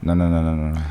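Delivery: ordinary talk, nothing marked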